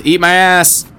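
loud high yell; angry shout. Acted. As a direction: snap back at the creep. Insults the creep.

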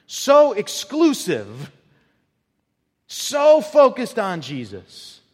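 high, loud, slow proclamation of the one way; full-force preaching. Acted. Preacher to congregation, about why believers were called the Way, preaching at full force. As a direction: preach it out at full volume, stretching the words.

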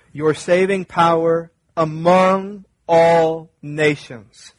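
slow reading that leans on "all nations"; targeted emphasis. Shown as a demonstration. Pastor reads the psalm to the church, stressing "all nations". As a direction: dwell on "among all nations", drawn out and falling. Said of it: "read it with a targeted emphasis".

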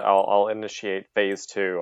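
usual speaking voice

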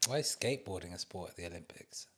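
hushed whisper; under the breath, unvoiced. Real, not acted. Whispered aside about sports.

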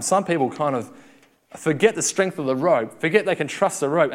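usual speaking voice

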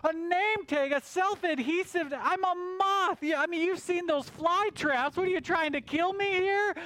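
a put-on bug voice taking offense; indignant moth. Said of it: "And he says".

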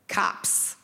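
low, breathy one-word reveal; hushed ominous reveal; dramatic hushed reveal. Acted. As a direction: drop it like a dark secret.

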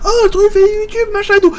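high squeaky imitation of the staff; way up in pitch, chattering; high gossiping girl voice. Imitating the waitresses gossiping about his videos, high-pitched. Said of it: "girls gossiping about him".

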